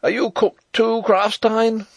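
high, loud called question; crying out. A narrator's reading. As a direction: shout the question across a fight. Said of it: "he cried".

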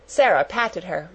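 ordinary delivery

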